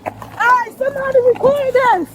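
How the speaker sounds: yelling out to bystanders, high and loud; shouting for help. Real, not acted. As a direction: call out loudly, near panic. Driver calls for bystanders to film.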